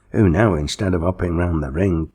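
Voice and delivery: plain reading voice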